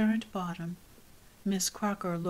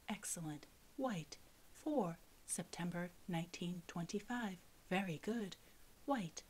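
softer, measured reading of each entry; hushed list reading; 10 dB quieter than usual. A narrator's reading. Narrator reading the book's chart entries, hushed. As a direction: quietly tick through the record.

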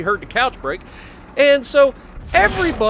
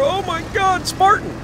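high, sudden gaming yelp at a teammate; excited outburst; 10 semitones higher than usual. Real, not acted. Gamer reacting to a teammate's move, excited high yelp. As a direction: blurt it out in surprise mid-match.

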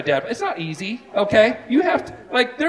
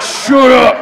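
loud laughing grumble; mock grumbling; 4 semitones higher, 11 dB louder than usual. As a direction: grumble like an embarrassed husband. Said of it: "All the guys are like".

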